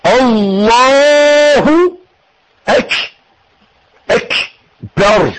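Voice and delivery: الله held long with a rounded u from the lips; rounded damma from the mouth. Shown as a demonstration. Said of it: "مش من المناخير".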